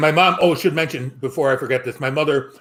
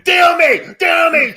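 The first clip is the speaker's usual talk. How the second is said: loud, high-pitched theatrical shout; mock scream; 13 semitones higher, 7 dB louder, much slower than usual. Woman in a lurid novel by night, mock screaming. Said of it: "and screaming".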